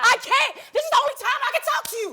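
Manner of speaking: finally yelling what she holds in, fast and high; real angry shout. Real, not acted. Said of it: "Look how you talking".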